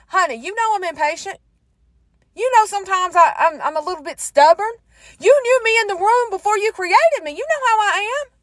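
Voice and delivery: high-pitched sassy talking back; protesting. Retold or imitated. Woman answering the Lord back about being impatient, high-pitched protest.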